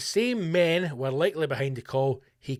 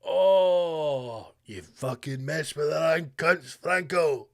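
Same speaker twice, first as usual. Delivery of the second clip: mock mafia threat; much slower than usual. Mock mafia mourning over shop.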